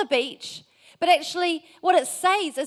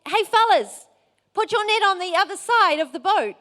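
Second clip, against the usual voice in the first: higher, louder call from the shore; retold yell; 6 semitones higher, 4 dB louder than usual. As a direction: call out to men in a boat. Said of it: "and, and he yells".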